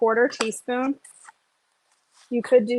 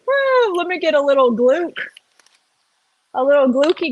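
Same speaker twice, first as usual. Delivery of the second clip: silly voice; 4 semitones higher, 4 dB louder than usual. Real, not acted. Playful goofy voice continues.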